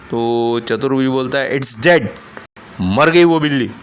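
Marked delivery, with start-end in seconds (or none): 1.6-3.7 loud curt anger; annoyed man snaps at the questioner. Acted. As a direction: snap the answer out. Angry repeat answer.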